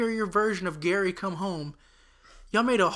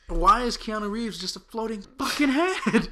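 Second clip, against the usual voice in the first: exasperated outburst; 6 semitones higher, 7 dB louder, slower than usual. Real, not acted. Exasperated rant about Keanu cameo.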